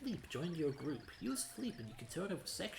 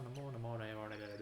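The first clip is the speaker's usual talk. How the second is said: normal talking voice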